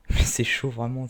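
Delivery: laughing loudly through the words; excited laugh. Real, not acted. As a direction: burst out laughing, thrilled. Thrilled by a good game.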